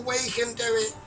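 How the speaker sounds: usual speaking voice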